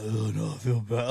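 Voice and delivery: mock sickly whimper, soft and pitiful; a touch of a faked sick kid; fake sick voice. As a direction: play the kid faking illness. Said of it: "exactly like that voice".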